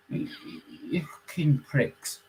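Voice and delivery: breathy whispered curse under the breath; whispered insult. Retold or imitated. Caller whispering insults at the fake coppers, a whispered curse. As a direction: hiss it quietly as a muttered insult.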